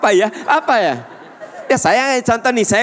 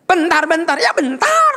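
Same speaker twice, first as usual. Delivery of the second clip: put-on child shouting back much higher and louder; impatient kid yelling from his room; 3 dB louder than usual.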